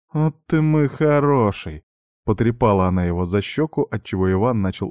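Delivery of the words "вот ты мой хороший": slow, high cooing; tender. Acted. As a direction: coo at him like a pet. Marjana pets the charmed Ivan.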